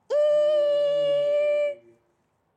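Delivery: E said in a little high voice; small high E. Shown as a demonstration. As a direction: lift the voice up on E. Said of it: "you can also do a little high voice".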